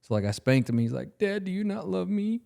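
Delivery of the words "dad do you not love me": put-on high, small kid voice; hurt child. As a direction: imitate a wounded little boy pleading. Spanked son's hurt question retold.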